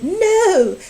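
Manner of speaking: high, loud, panicky protest; the fish squealing to throw them out; alarmed fish. A narrator's reading. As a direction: cry out in alarm at the intruders. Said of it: "our fish said".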